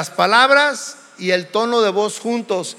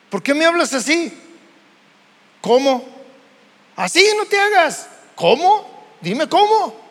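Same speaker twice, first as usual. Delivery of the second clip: high, fast, indignant ¿Cómo?; offended retort; 6 semitones higher than usual. Acted. Spouse answering a harsh tone, indignant and high-pitched. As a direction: fire back, offended and higher. Acted quarrel over harsh tone.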